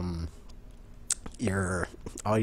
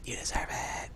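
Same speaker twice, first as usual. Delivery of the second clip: hushed sinister whisper; menacing whisper; 9 dB quieter than usual. Acted. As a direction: whisper it like a threat. Repeated as menace.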